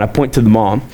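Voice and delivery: usual speaking voice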